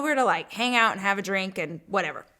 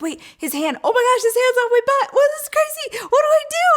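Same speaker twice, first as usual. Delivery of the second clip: very high, squealed inner panic; freaking out; 14 semitones higher, 5 dB louder than usual. Retold or imitated. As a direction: retell the panic in a fast, rising squeal. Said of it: "I'm just freaking out in my head".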